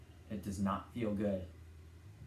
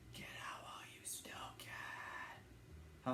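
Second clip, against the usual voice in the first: slow, eerie whisper; creepy whisper; 15 dB quieter, slower than usual. Pretend. Narrator warning the player to escape, in a slow whisper. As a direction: breathe a warning, slow and spooky. Quoting the Baldi game.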